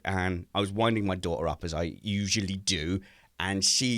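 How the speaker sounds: usual speaking voice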